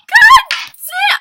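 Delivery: very high, loud cry of dismay; dismayed shout. Retold or imitated. Girl realising the guy heard her, dismayed shout. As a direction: yelp the curse in mock panic.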